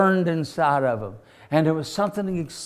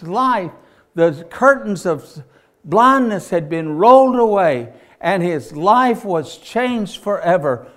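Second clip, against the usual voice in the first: louder, higher rejoicing preacher; preaching fervor; 5 semitones higher, 5 dB louder than usual. Acted. Preacher telling the congregation of the healing, louder and rejoicing. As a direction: build the joy of the healing.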